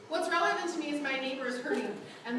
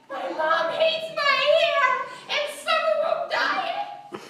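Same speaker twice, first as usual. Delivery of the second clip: much higher, slow, sing-song wail; overdramatic teen; 11 semitones higher, 8 dB louder, slower than usual. Retold or imitated. Teen misreading a text about her hair, wailing melodramatically. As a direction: wail it like a misread, heartbroken teenager. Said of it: "it's interpreted by her emotions".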